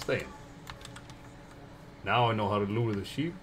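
normal talking voice

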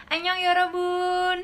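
high, loud, slow Korean-style sing-song; mock Korean greeting. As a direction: greet the fans like a K-pop idol.

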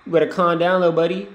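ordinary talk, nothing marked

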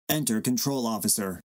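ordinary talk, nothing marked

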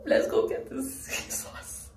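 high, slow, breathy laughing whisper. Real, not acted. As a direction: giggle it out under your breath. Laughs at her own decision.

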